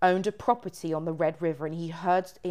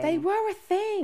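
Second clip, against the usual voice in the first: high-pitched echo; 4 dB louder than usual. Real, not acted. Echoes line high and loud.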